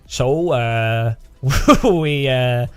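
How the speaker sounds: put-on a modest Finnish promo voice in a hesitant Finnish accent; attempted Finnish accent. Imitating a humble Finnish company video in a shy Finnish accent. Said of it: "I can't do Finnish accent".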